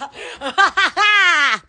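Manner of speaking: long open laugh; drawn-out burst of laughter; laughing fit. Real, not acted. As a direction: let a laugh run out long. Laughing fit amid the clowning.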